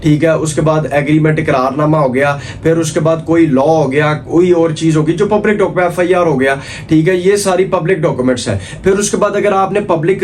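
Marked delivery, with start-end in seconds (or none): none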